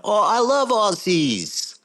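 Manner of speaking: high, drawn-out gushing exclamation; slow, high-pitched mock enthusiasm; mock delight. Acting a bar local's delighted reply.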